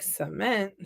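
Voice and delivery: quiet "Cement," as suh-MENT; suh-MENT pronunciation. Retold or imitated. Counselor tests campers' word, quiet "Cement," as suh-MENT. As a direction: try "Cement," softly, second syllable stressed. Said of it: "You mean cement?".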